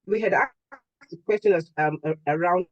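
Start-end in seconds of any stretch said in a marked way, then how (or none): none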